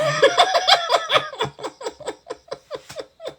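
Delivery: long, very high, loud squealing laughter; big laugh. Real, not acted. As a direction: howl with laughter. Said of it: "I don't know why I laugh like that".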